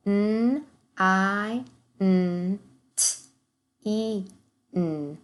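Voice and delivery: N, I, N, T, E, N said as separate sounds; segmenting sounds. Shown as a demonstration. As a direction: sound out nineteen piece by piece. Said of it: "segment the rest of our words".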